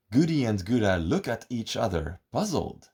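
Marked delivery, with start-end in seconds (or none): none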